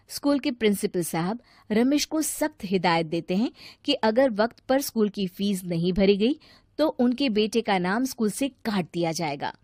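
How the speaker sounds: ordinary delivery, nothing marked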